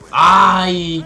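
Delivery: loud sudden "ay" yelp; pained yelp. Real, not acted. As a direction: yelp as the shot lands. Gets hit in game.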